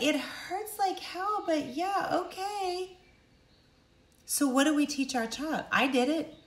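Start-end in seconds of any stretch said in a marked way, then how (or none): 0.0-3.0 put-on high, slow little-kid voice; high baby voice, slowly giving in; hurt baby. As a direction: imitate a hurt child reluctantly agreeing. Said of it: "This baby is like".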